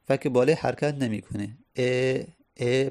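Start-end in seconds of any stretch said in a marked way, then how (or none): 1.8-2.9 slow, held "ا" as an eh sound; kasra vowel. Shown as a demonstration.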